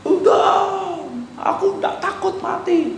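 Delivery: high, slow mock bravado; a proud man showing off, hammed-up swagger; boasting. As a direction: brag like a cocky man daring death. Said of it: "kalau orang mau nyombong".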